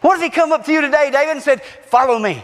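high, exultant sermon climax; fired-up preacher with a whoop; shouted preaching. Real, not acted. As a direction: cry it out to the whole church. Preaches at full force.

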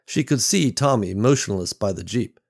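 ordinary delivery, nothing marked

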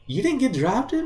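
high, shocked question; retold shocked disbelief. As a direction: squeak it out in disbelief. Disbelief at player not drafted.

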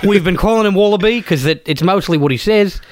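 broad mock Aussie; hammed-up Aussie bloke; Australian accent. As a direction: lay on a thick Australian accent. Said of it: "doing Australian accents".

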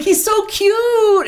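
high, drawn-out swoon over someone cute; gushing mock squeal. Imagining a woman swooning over a character, gushing squeal. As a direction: coo it very high, stretching it out.

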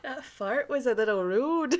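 bad Canadian accent with a laugh; badly faked Canadian, tickled by the joke; mock Canadian accent. Host riffing on a fart, laughing mock Canadian accent.

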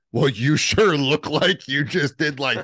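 slow, high, teasing accusation; disbelieving roommate. Retold or imitated. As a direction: imitate a friend not buying it. Roommate's acted comeback.

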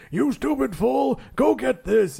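high, drawn-out boss rant; mock angry yell. Comic fan imitating newspaper boss yelling at everybody, mock yell. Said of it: "J. Jonah Jameson's just yelling at everybody".

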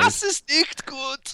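high, slow put-on German voice; German accent. Comic German line in a put-on voice.

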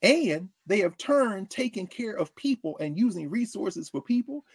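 ordinary talk

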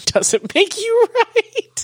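high, drawn-out retold scream; mock scream. Co-host retelling yelling in his face, mock scream. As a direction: stretch it out in a high mock yell. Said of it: "I screamed it in his face".

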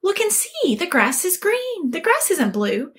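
high-pitched, exasperated tiger; arguing tiger. Acted. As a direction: contradict the donkey in a playful squabble. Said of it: "the tiger got into it with the donkey".

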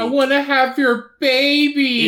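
high, slow, crying mock plea; mock grandmother pleading. Mocking the grandmother offering her son a baby, high fake crying. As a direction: whine it like a weepy mother.

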